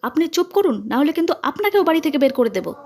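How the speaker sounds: high, threatening outburst; angry shout. A narrator's reading. Mother threatening to throw out the aunt, angry shout. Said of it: "চিৎকার করে বলল".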